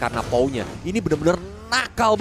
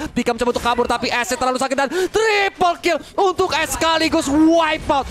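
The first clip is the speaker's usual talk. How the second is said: fast, rising hype play-by-play; fighting game announcer; 5 semitones higher than usual. Acted. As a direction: build to the game-winning call. Climactic play-by-play of final wipe.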